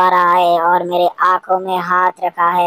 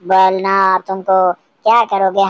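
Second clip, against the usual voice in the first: normal talking voice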